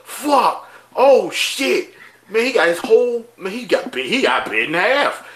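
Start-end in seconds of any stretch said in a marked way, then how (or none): 0.0-1.9 high-pitched dismayed exclamations; stunned curses, pitched up; retold shock. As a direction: react as if watching each death. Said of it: "we was like".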